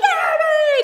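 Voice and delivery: very high, loud eager yell; excited kids. Retold or imitated. Camp kids answering an altar call after a concert, excited yell. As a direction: shout it like an overexcited camper. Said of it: "they're all excited".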